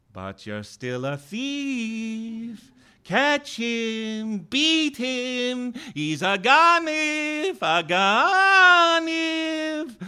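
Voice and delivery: slow, high, eerie distant call; distant accusing voice. A narrator's reading. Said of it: "I heard a distant voice saying".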